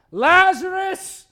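loud, high, drawn-out summons; commanding call. Acted. Preacher plays Jesus calling into the tomb, commanding call. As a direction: call the name out long and strong.

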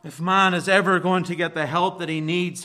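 usual speaking voice

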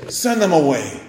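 curt, pushy demand to send them off; rude dismissal. Retold or imitated. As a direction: snap it out like impatient disciples. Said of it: "Oh, how rude".

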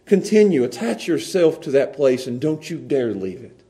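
ordinary talk, nothing marked

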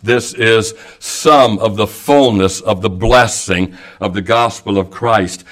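ordinary talk, nothing marked

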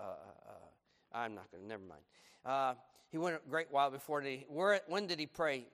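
ordinary talk

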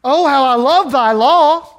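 loud, high, slow exclamation of love; exclaimed with joy. Shown as a demonstration. Preacher showing the congregation the exclamation point, loud and joyful. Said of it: "That's what it says".

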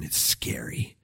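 hushed, low, timid whisper; fearful whisper. Acted. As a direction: whisper it like a cowed scout. Spies voiced refusing to enter.

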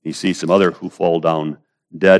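ordinary talk

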